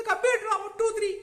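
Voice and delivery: very high, loud character voice; high-pitched squawk. Acted. Brother answering the detective's questions, high loud squeal. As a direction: squeal it out at the top of the voice. Acted brother blurts out reply.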